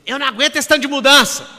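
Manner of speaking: loud high mocking whine of a coworker; loud, high mock complaint; whiny complaining coworker. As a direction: mimic a coworker moaning about change, loud and high. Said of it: "eu via pessoas falando assim".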